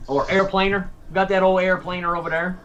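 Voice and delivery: "airplaner" with an extra R stuck on the end; added R. Retold or imitated. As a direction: end "airplaner" on a made-up R sound. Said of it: "they add R's to things that don't exist".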